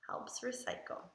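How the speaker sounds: whispered title, unvoiced and hushed. Shown as a demonstration. Reader whispers the book title to the children, hushed. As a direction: breathe the title out in a hush. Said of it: "title whispered after plain read".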